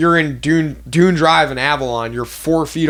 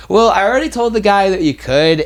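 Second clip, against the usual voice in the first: higher, drawn-up mock customer voice; pushy customer; 6 semitones higher than usual. Customer protesting to the waitress, pushy mock voice.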